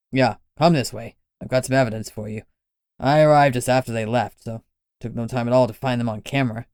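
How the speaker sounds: put-on curious, easy-going old man explaining; a wily older man, unbothered. Lenny leads them.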